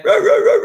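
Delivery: high, loud barking "row, row, row"; playful rottweiler bark impression; mock dog bark.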